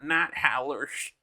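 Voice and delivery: slow southern accent with a lisp; lisping southern drawl. Shown as a demonstration. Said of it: "you can add that lisp".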